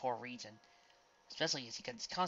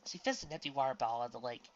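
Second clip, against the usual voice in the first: ordinary delivery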